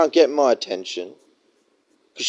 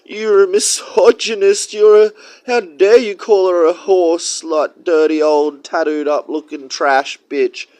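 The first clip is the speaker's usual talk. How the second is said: higher, louder hammed-up outrage; mock offended critic; 7 semitones higher, 5 dB louder than usual. Mocking critics who defend her, loud and shrill. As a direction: whine like a scandalised do-gooder. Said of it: "you're like".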